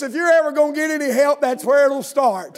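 loud, high, fervent sermon delivery; preaching at full force. Acted. Preacher exhorting his congregation, shouting fervently. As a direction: press the point hard on the congregation.

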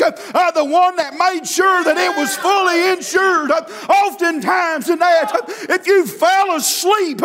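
revival sermon winding up to a shout; chanted preaching, rising in pitch and fervour; cadenced preaching. Real, not acted. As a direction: punch out rhythmic phrases, each capped with a gasped "uh". Preacher winds up again to full force.

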